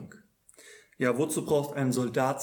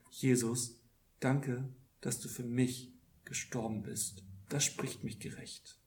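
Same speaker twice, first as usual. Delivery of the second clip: soft prayer; 7 dB quieter than usual. Shown as a demonstration. Preacher models a grateful prayer to Jesus, softly hushed. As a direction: drop to a near-whispered thank-you.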